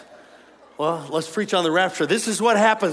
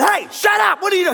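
much higher, loud mock yell of an annoyed dad; 12 semitones higher, 6 dB louder than usual. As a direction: bark it like a dad cutting in on the phone. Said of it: "My dad said".

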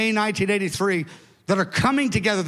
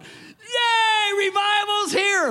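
joyful yell; 13 semitones higher, much slower than usual. Retold or imitated. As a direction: shout it like the revival just arrived. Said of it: "screaming and hollering".

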